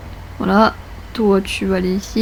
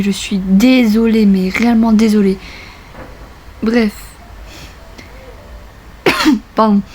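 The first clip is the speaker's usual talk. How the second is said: ordinary talk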